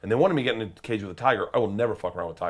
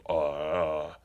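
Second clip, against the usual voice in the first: very dry; 4 semitones lower than usual. Retold or imitated. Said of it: "And just very dry, he goes".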